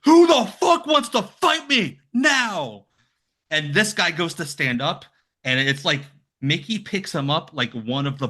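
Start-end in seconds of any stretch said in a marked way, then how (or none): 0.1-2.9 much higher, louder and slower, like bursting through the door; furious challenge. Retold or imitated. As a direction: bellow it out to the whole room, daring anyone. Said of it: "he kicks open the door and he goes".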